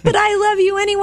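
high, fast goofy goose voice. Pretend. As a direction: squeak it out like a silly goose. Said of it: "Is that your goose voice?".

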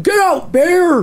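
high mock shout at a bear; scaring off a bear. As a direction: pretend to shoo a bear away. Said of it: "stand up and be like".

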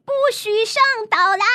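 high, loud possessive yell; shouting frog. Acted. Rupert warns the frogs off his island, loud and high. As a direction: bark it out to keep others off the island. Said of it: "鲁伯特大叫".

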